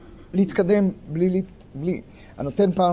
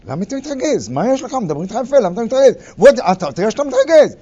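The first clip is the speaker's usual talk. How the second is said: higher and louder, sneering calm-down; mocking bait; 6 semitones higher, 9 dB louder, faster than usual. Lawyer provoking opponent in court, higher and louder mock concern. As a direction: goad the other side with false concern.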